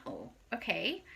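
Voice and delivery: hushed curious reply; whisper. Retold or imitated. She answers the date's surprise, whispered. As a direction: barely breathe it back. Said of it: "And I'm like".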